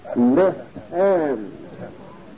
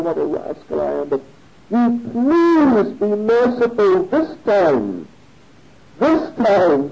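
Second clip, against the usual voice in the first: earnest pleading; 6 semitones higher than usual. Retold or imitated. Devotee begs the Lord to accept his offering, imploring. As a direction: beg for mercy, mostly raised in pitch. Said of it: "this time he really prayed".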